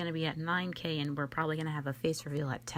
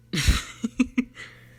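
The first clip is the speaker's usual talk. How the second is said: loud, high burst of real laughter; 9 semitones higher, 9 dB louder than usual. Real, not acted. Vlogger cracking up at her own teasing of viewers, loud laughter. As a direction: crack up loudly at your own joke.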